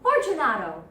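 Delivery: loud, impatient call; calling aloud. Acted. Montresor calling into the niche, loud. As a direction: call out sharply for an answer. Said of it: "I called aloud".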